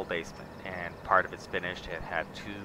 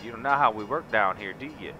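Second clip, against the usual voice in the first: southern ranch talk; 3 semitones higher than usual. Acted. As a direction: lay on a big Southern accent, welcoming a partner. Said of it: "I could go all southern on you".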